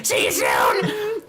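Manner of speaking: very high, slow called-out goodbye; little girl goodbye. Acted. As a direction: sing it out like a small girl.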